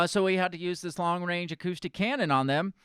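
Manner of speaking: fast, loud mock innocence; sarcastic. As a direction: rattle off the excuses sarcastically. Mocking police excuses.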